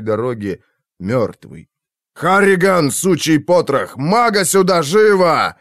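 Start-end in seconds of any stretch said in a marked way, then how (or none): 2.1-5.6 furious bellowed command; yelling. A narrator's reading. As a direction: bark it across the battlefield. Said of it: "снова проорал Ром".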